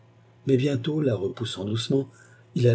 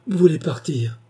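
high-pitched, distressed question; dismayed at being left; heartbroken cry; 8 semitones higher, 4 dB louder than usual. A narrator's reading. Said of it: "s'écria-t-il d'une voix navrée".

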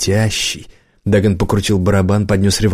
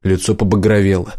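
plain reading voice

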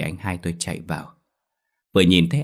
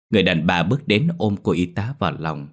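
ordinary delivery, nothing marked

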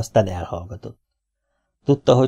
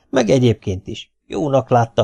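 usual speaking voice

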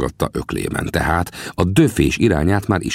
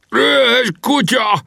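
very high, drawn-out howl of rage; slow, screeched-up roar; 3 dB louder, slower than usual. A narrator's reading. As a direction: bellow the curse as if just stabbed. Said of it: "ordította".

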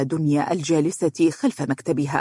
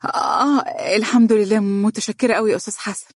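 a startled, much higher, halting reply; flustered; 6 semitones higher than usual. A narrator's reading. Dunya answers colleague Hassan's greeting, flustered and nervous. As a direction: caught off guard, fumbling for words. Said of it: "قالت بارتباك".

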